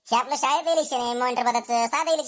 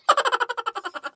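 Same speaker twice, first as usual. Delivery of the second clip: giggle; 10 semitones higher than usual. Acted. A student laughs at the teacher.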